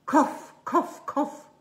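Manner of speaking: high, loud imitated coughs; high animal cough; possum coughs. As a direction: mimic a little animal coughing. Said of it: "a coughing possum".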